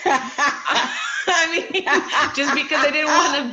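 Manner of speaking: real laughter breaking up the words; laughing. Real, not acted. Admitting grocery-store excuses to the group, laughing.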